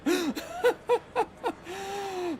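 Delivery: high wheezing laugh; real high, breathless laughing fit; helpless laughter. Real, not acted. Player cracks up at own dark joke, helpless laughter.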